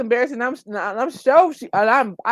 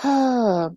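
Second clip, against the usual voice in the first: audible exhaled sigh; 3 semitones higher than usual. Real, not acted. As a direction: let out a weary sigh. Sighs before reading the apology.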